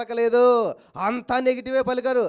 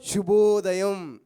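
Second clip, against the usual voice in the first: 1 drawn-out greeting; 3 semitones lower, 4 dB louder, much slower than usual. Shown as a demonstration. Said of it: "పొద్దున్నే మనం ఏమంటాం?".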